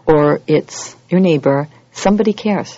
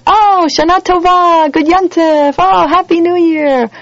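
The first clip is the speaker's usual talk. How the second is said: much higher, louder, slow sing-song holiday greeting; mock cheery greetings; 12 semitones higher, 6 dB louder, slower than usual. Mocking oblivious worshippers, loud cheery sing-song greetings. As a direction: gush like an oblivious partygoer. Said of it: "walking around on Rosh Hashanah going".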